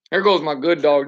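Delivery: ordinary delivery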